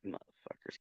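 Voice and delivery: breathy whispered swear; whispered curse. Real, not acted. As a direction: whisper it bitterly. Muttered curse after laughing.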